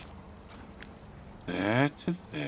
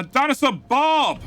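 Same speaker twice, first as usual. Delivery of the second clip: loud, drawn-out call of a name; 14 semitones higher, 6 dB louder, slower than usual. Real, not acted. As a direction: hail him across the room. Calling out to a viewer.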